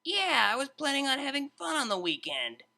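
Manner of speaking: a high, casual sarcastic quip; lightly voiced DJ. A narrator's reading. Vinyl grumbling to the tutor, high sarcastic voice. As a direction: toss it off flippantly.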